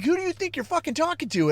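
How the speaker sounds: high-pitched indignant tirade; indignant outrage. Retold or imitated. A man berating a chatbot that talked back, high and indignant. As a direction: put the machine in its place.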